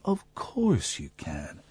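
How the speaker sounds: slow, soft and low; gentle slow child's voice; very slowly. Retold or imitated. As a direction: draw each word out gently. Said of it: "so it's very slowly".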